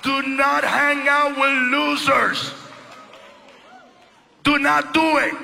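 slow it right down and order it; preaching in English. Acted. Preaching at full force.

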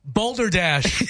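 usual speaking voice

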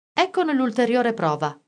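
ordinary delivery

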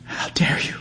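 hissed under the breath; How dare you hissed in a voiceless whisper; whispered snarl. A narrator's reading. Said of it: "She snarls".

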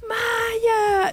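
a sobbing high scream of the dog's name; tearful telenovela-style scream as her dog comes home; crying shout. Retold or imitated. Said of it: "así gritando".

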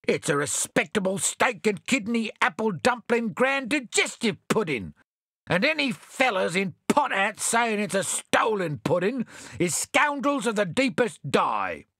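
high, outraged exclamation; indignant Bill. A narrator's reading. Bill exclaims to the strangers, high and indignant. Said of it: "exclaimed Bill".

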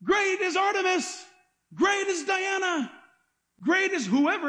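high, slow, drawn-out acclamation; mock worship shout. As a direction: imitate worshippers calling up to their god. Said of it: "They would shout up and say".

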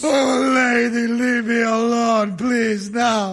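sobbing plea, slowed; crying. Acted. Trucker weeping after the fight begs the widow, sobbing. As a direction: beg through tears to be left alone. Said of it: "I got to cry after a fight".

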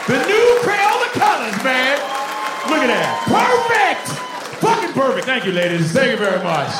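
loud, high showman's shout; over-the-top product unveiling; mock pitchman. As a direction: hawk it to the whole crowd. Mock pitchman unveils crayon colors.

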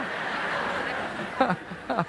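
an airy laugh at his own joke; breathy laugh. Real, not acted. Pastor laughing at his own lawn joke, soft breathy laugh.

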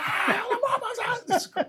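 long, high, slow joyful cry; howling his joy into the mic. Retold or imitated. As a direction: sing out a drawn-out cry of joy. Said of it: "singing out into the mic".

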